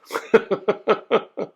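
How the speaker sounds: high-pitched burst of laughter; amused laugh. Real, not acted. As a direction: break into a run of high chuckles. Amused at mock fighting words.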